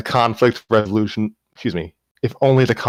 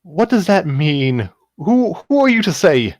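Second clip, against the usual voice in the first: snapping; 10 semitones higher, 4 dB louder than usual. Acted. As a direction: fire the question back, stung and defensive. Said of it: "She snapped".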